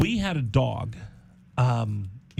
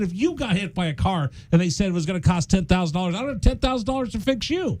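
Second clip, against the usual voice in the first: exasperated rant; 5 semitones higher than usual. Retold or imitated. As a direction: pitch climbing higher into mock outrage. Acted quarrel with his wife.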